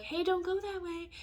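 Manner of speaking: high, kindly warning; a sweet nudge in the right direction; gentle universe. Pretend. The universe warns you kindly, high voice. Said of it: "the universe is like".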